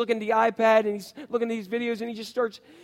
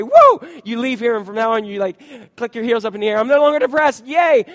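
louder, higher mock-elated cheer; 4 semitones higher, 9 dB louder than usual. Pastor mocks easy answers to depression, louder cheering. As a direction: ham up a cheap instant cure. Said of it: "not gonna give you the answer and say".

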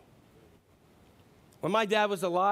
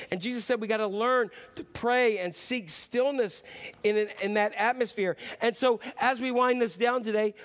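louder, higher sermon drive; preaching at full force; 5 semitones higher, 4 dB louder than usual. Acted. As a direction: press the point home to the congregation.

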